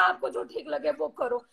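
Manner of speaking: soft, low retold voice of a son; gentle reassurance. Son telling his mother to decide herself, gentle reassurance. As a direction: quietly give permission, voice dropped. Retelling son's gentle permission.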